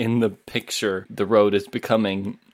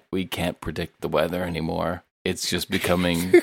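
defeated weatherman; 3 semitones lower, 5 dB quieter than usual. Acted. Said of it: "a guy's just like".

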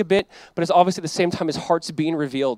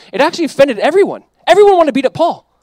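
much higher and louder, driving the point home; emphatic loud preaching; 9 semitones higher, 7 dB louder than usual. Real, not acted. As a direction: hammer it out like a preacher at full force. Preacher stressing everyone was offended.

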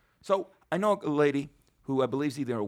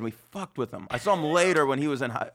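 ordinary talk

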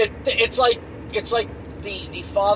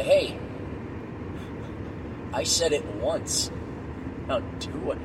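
low, softer, menacing order; quiet stern warning; 3 semitones lower, 8 dB quieter than usual. Retold or imitated. Father warning kids he said it once, low and menacing. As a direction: warn them once, quietly. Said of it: "Like, uh".

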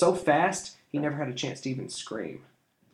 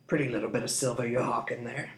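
covetous, softer thug's voice; greedy thief; 3 dB quieter than usual. A narrator's reading. Thief eyeing the boy's kettle, greedy. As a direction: savor the silver greedily. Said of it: "he uttered, avidly staring".

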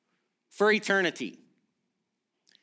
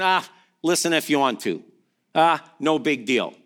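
low, offhand shrug of a voice; mock indifference; 7 semitones lower than usual. Preacher acts out what Jesus isn't saying, offhand. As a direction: toss it off as if it hardly matters. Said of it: "Jesus just isn't saying".

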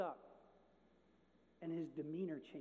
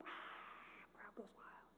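whispered crowd roar; 13 dB quieter, much slower than usual. Retold or imitated. Mimicking crowd noise.

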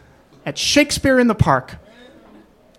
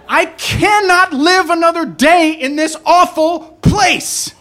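screaming breakdown; 8 semitones higher than usual. Retold or imitated. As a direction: scream it at the city. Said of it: "banging on the steering wheel and screaming".

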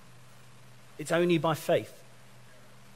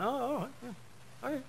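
reluctant giving in; 11 semitones higher, 9 dB quieter than usual. Retold or imitated. Grudgingly answering God in retelling.

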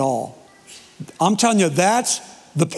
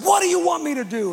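pitched way up, a mock scream at God without much extra volume; retold scream at God; 10 semitones higher, faster than usual. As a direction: cry it out high, like shouting at heaven. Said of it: "I screamed it out".

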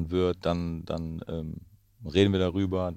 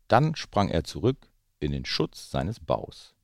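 put-on lively read-aloud voice for children; story narration; 3 semitones higher, 5 dB louder, slower than usual. A narrator's reading. Parent reading The Gruffalo to the children, lively storytelling. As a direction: tell it like a bedtime story. Reads picture book aloud.

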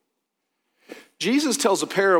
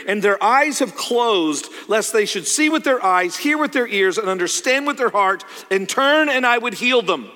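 raised, ringing prophecy reading; scripture proclamation; 4 semitones higher, 4 dB louder than usual. Acted. Preacher reads Isaiah's prophecy to the congregation, emphatic proclamation. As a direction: proclaim it, punching the key verbs high.

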